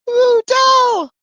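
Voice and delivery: bright, loud, high hello; excited greeting. Real, not acted. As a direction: greet a friend with delight. Excited greeting of guest joining.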